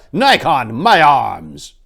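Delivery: loud order for his arms; urgent call. Acted. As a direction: call out sharply for your weapons. Officer barks for his weapons.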